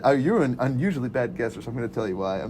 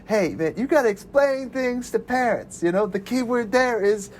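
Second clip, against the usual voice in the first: put-on high, easygoing teen stretching out vacation; laid-back teen voice; 8 semitones higher, slower than usual. Teen explaining summer to parents, high and laid-back. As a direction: sell the summer plan, dragging out vacation. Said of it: "he's just like".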